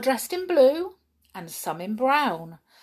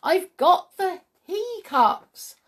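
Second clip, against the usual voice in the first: put-on higher, slow sing-song girl; little girl, sing-song; 11 semitones higher, 6 dB louder, slower than usual. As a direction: sing it out again, gleefully. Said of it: "cried Sheila".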